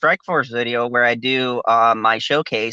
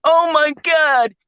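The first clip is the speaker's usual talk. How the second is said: mocking the outraged critics; 11 semitones higher than usual. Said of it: "because they're like".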